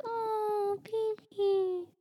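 put-on high-pitched cooing baby voice; baby talk. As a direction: coo it like a toddler. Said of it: "Talking in baby talk".

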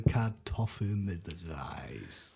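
tief, langsam und rauchig angesagt; rauchige Stimme. Acted. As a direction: sag es verrucht wie ein Jingle. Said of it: "du meinst so mit rauchiger Stimme". (rauchige, tiefe Ansagestimme)

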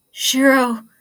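loud desperate shout of a name; panicked cry. A narrator's reading. Lance crying out to Shiro in panic, loud desperate shout. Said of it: "Lance's voice cracked under the weight of his panicked desperation".

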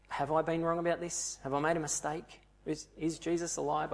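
hushed, hesitant questioning; acted self-doubt. Saul wondering if he was wrong about Jesus, uneasy. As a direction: question yourself quietly, half to yourself.

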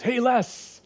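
high, loud call across a dark park; calling out from afar. Retold or imitated. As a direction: shout the name to someone far away. Man calls out across park.

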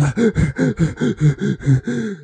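slow exhale of relief; long heavy sigh. Retold or imitated. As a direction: let out a big tired sigh. Catching breath after practice.

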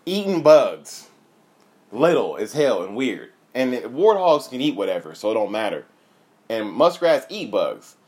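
ordinary talk

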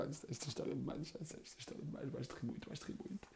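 hushed, teasing whisper; hushed mother's teasing build-up. Retold or imitated. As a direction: lean in and whisper the tease.

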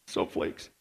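breathy whispered aside. Real, not acted. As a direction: whisper it as a muttered joke. Aside repeating the joke.

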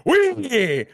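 high, drawn-out fight announcer call; Bruce Buffer. Retold or imitated. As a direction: stretch it like a UFC ring announcer. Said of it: "Bruce Buffer".

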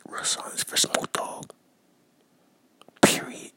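very soft, reverent whisper; hushed whisper. Real, not acted. Host opening a moment of silence for [name], whispered.

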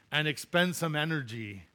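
usual speaking voice

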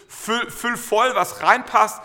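egging himself on to fill it right up; eager self-talk. Retold or imitated. Self-talk while filling the tank.

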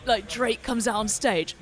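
normal talking voice

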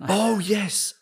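high-pitched dawning realisation; sudden recollection. Real, not acted. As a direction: blurt it as the memory clicks. Suddenly remembers the incident.

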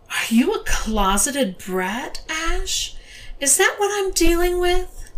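slow then high scornful question; mocking dominatrix. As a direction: sneer at the brat. Mistress interrogates, pitch up.

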